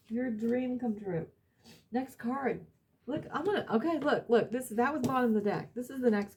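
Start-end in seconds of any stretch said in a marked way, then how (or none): none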